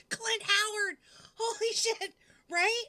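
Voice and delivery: high, loud burst of excitement; excited outburst. Real, not acted. Streamer hits on a casting idea, loud and excited. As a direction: blurt it out thrilled with the idea.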